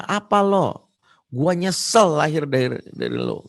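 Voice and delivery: teen sneering at mother, mildly acted; rude retort. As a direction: snap it at mom with contempt. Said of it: "Giliran mamanya".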